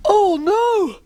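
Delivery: high, drawn-out comic wail; hammed-up cry of dismay. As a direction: wail in mock despair. Puppet covered in custard, dismayed.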